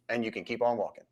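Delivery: ordinary talk, nothing marked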